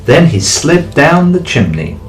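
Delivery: ordinary delivery, nothing marked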